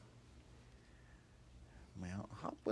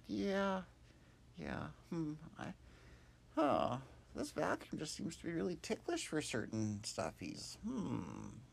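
musing Hop; 10 semitones higher, 5 dB louder than usual. Acted. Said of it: "And Hop said".

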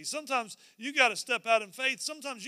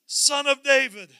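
calling out; 4 semitones higher, 8 dB louder than usual. Acted. Blind men calling after Jesus for mercy, calling out. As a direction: cry out for mercy, raised and stretched. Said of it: "they're calling out, and they say".

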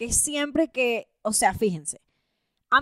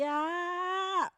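mock ecstatic cry; 6 semitones higher, 11 dB quieter than usual.

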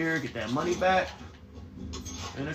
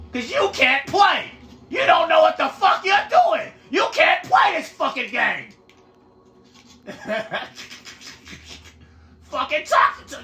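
angry yell; 12 semitones higher than usual. Real, not acted. Heated rant at laughing teammate.

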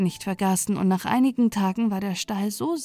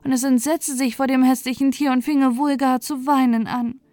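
high, agitated little bird queen, fretting; higher, louder queen wren, alarmed at the dirt; excited wren queen; 5 semitones higher, 4 dB louder than usual. A narrator's reading. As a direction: chatter like a flustered tiny queen bird. Queen wren's voice.

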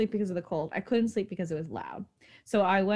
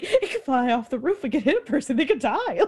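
higher, a bit louder half-laughing panic; mock-hysterical, pitched up; retold hysterical panic; 4 semitones higher, 3 dB louder than usual. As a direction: replay the frantic worry. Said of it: "I become hysterical".

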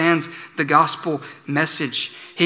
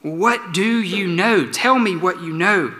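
slow, raised demanding question; pressing gatekeeper; 4 semitones higher, slower than usual. Retold or imitated. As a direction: lean on each word to get an answer. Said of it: "the gatekeeper".

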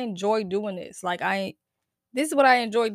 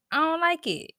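insistent complaint; 8 semitones higher than usual. Real, not acted. Repeats complaint higher.

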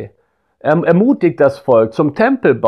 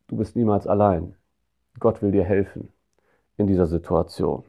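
much softer and lower, tender and intimate; hushed comfort; 5 semitones lower, 6 dB quieter than usual. Acted. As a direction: say it like a hand on the shoulder. Comforting worried parents.

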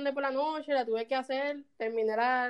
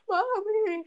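high mock wail; imitated crying; 9 semitones higher than usual. Girl phoning her parents after the accident, mock sobbing wail. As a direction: whine it tearfully, hammed-up. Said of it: "yo llorando hasta acá".

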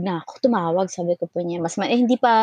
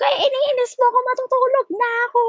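much higher annoyed whine; exasperated whine. Retold or imitated. Telling the caller she wants to sleep, high exasperated whine. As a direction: whine that you just want to sleep. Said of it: "Sabi ko".

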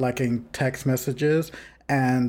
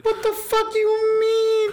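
much higher, slow, loud disbelief; outraged disbelief; 4 dB louder, slower than usual. Real, not acted. Host reacting to the boyfriend cutting off the phone, outraged. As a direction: stretch it out in shocked outrage.

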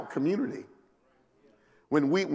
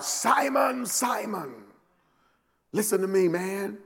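higher, urgent calling of Simon; yelled out; 4 semitones higher, slower than usual. Shown as a demonstration. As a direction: call him out sharply. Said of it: "I think he yelled it out".

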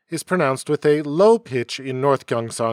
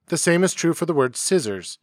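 ordinary talk, nothing marked

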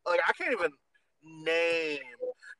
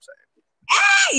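high, loud, drawn-out fangirl cry; gushing about Daddy Lucifer with a slow high squeal; swooning squeal; 13 dB louder than usual. Real, not acted. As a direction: squeal it out, slowly.

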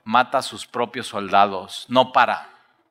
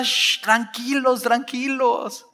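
calming; 10 semitones higher, much slower than usual. Acted. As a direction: settle them down slowly. Pedro calming them.

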